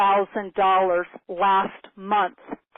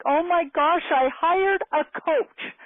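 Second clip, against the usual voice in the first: imitating dad's excitement, high and slow; animated dad; 8 semitones higher, slower than usual. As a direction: gush it like a thrilled father. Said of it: "he got passionate and ex-, super animated".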